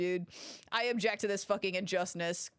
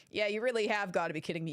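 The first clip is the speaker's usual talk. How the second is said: normal talking voice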